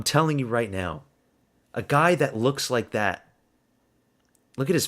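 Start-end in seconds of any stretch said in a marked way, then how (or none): none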